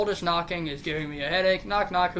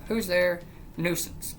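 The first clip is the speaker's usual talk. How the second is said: ordinary talk, nothing marked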